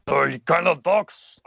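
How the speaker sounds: throaty Russian character voice; Heavy. Shown as a demonstration. Voice actor showing how Heavy talks, throaty and Russian-accented. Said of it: "Heavy kind of talks from the throat".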